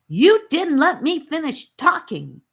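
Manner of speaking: high, loud indignant cry; girl cries out against being cut off; indignant protest. Acted. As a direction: push back, offended.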